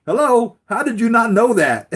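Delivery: very high, loud disbelieving squeal; incredulous villain. Retold or imitated. Villain exasperated at Lois, very high loud disbelief. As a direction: shriek the obvious at her.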